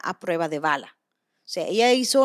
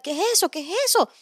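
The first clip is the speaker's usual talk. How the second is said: put-on panicked kids; 9 semitones higher, faster than usual. Mom retelling her daughters' panic at the alarm, high mock panic. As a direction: imitate frightened little girls crying out. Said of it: "empiezan a gritar".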